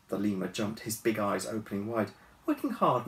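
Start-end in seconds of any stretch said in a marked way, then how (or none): 2.4-3.1 put-on high teasing girl. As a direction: tease him, high. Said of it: "she said".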